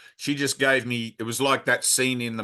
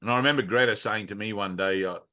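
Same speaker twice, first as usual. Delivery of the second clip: ordinary talk, nothing marked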